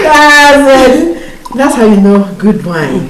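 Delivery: delighted toast. Acted. Celebrating wine.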